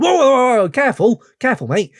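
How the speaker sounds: high, loud burst of alarm, easing off; mock-startled, warn a mate to back off; acted jolt, settling into a caution. Acts out being jolted mid-song.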